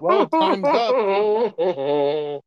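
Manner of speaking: slow, drawn-out put-on host voice; mock host. Imitating the show ending before Hunter gets anywhere, slow drawn-out host voice. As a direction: drag it out like a host cutting the segment. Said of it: "they're like".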